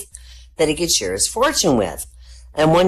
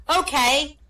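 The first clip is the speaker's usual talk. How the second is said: put-on high, airy ditzy girl voice; ditzy young woman; 9 semitones higher, slower than usual. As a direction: answer brightly like a clueless student. Student's reply.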